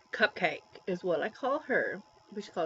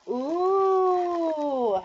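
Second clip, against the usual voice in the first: delighted ooh; 7 semitones higher than usual. Real, not acted. Opening a birthday package.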